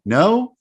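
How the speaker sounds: a high questioning "no", rising sharply; varied tone demo. Shown as a demonstration. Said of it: "How am I saying it".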